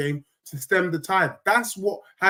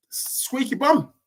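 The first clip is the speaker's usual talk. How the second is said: slow, higher squeaky bum in a put-on voice; mock squeaky voice; 6 semitones higher, slower than usual. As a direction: tease them with a squeaky put-on voice. Mocking a nervous rival fan.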